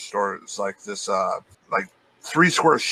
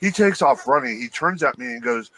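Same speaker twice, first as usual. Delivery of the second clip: ordinary delivery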